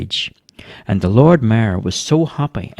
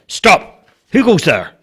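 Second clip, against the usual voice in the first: firm, higher-pitched sentry's shout; shouted challenge; 9 semitones higher, slower than usual. A narrator's reading. Bobo challenging whoever approaches the barricade, shouted. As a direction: jump out and challenge sharply. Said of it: "jumped out ... and shouted".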